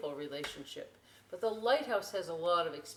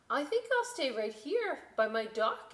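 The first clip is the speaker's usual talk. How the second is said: higher, small boat voice; timid little boat; 8 semitones higher, 5 dB louder than usual. Acted. Little boat declining to sail, higher and timid. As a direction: hang back shyly by the dock.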